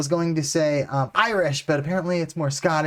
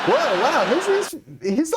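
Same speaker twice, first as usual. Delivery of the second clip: startled wow; 10 semitones higher than usual. Real, not acted. Shocked by the comedian's accent.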